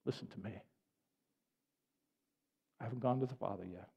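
soft, low, calming voice; quiet reassurance. Acted. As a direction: drop soft and low to steady the listener. Jesus calming Mary.

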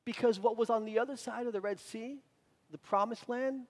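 ordinary delivery

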